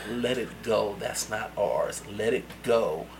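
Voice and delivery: low, soft and slow urgent hush; quietly pressing him to drop it; hushed warning. Retold or imitated. Little friend warning him at the club, low hushed plea.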